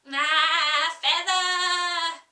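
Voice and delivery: loud, sung-out cry of a sheep. Acted. Sheep calling to Alice at the oars, loudly sung out. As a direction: call out as a bossy old ewe. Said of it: "cried the sheep".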